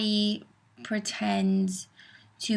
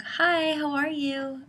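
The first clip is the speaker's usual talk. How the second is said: high, chirpy restaurant greeting; put-on friendly server hello; friendly greeter; 7 semitones higher, slower than usual. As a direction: greet a customer in a high, lilting voice. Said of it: "I'll be like".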